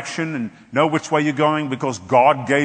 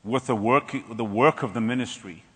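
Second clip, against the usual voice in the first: slow, lower, soft reproach; gentle voice of God; 4 semitones lower, 5 dB quieter than usual. Retold or imitated. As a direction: speak softly like a loving God. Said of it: "he said".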